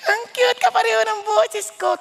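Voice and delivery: high-pitched gushing coo; squeaky, hammed-up adoration; delighted doting parent. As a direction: gush over your own child's singing. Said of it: "tuwang-tuwa ka".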